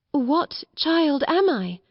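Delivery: falter, drawing the words out slowly. A narrator's reading. Bewildered girl asks Mr Carmichael, faltering. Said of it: "she faltered".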